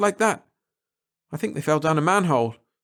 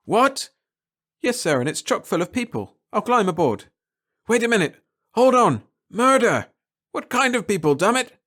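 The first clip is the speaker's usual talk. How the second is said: alarmed shouting; 5 semitones higher, 3 dB louder than usual. Acted. Captain alarmed over radio.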